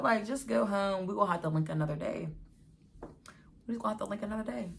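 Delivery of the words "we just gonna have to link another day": fast, tossed-off repeat; rushed brush-off. Retold or imitated. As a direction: rattle it off to end it. Quick dismissive repeat.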